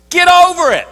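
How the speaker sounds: high, loud and blunt; shouted punchline. Real, not acted. Preacher telling complainers to move on, loud and high. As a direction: fire it at them like a slap.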